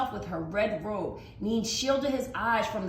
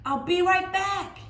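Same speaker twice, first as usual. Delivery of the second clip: put-on much higher, louder young girl; girl calling out; 13 semitones higher, 7 dB louder than usual. Mai calls to Mama while running off, high and loud. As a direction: call back over your shoulder as you run.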